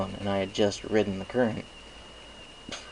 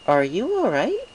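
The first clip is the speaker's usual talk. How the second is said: higher, caring question; Twilight Sparkle concerned; 11 semitones higher, 5 dB louder than usual. A narrator's reading. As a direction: reach out to someone retreating.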